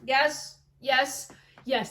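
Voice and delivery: cheering approval. Real, not acted. Cheers Annie's comeback.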